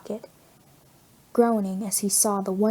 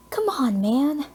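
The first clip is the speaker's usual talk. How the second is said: complaining; 6 semitones higher, slower than usual. A narrator's reading. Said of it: "the masked vigilante complains".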